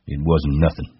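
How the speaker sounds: listless, understated voice; dull. A narrator's reading. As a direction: brush it off without feeling. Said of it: "Crooks said dully".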